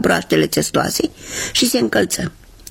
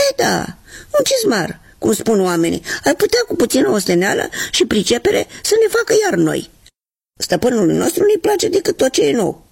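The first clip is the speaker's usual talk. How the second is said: higher, quicker put-on shoe voice; shoe character voice; 8 semitones higher than usual. Left shoe answering its twin, higher bitter voice.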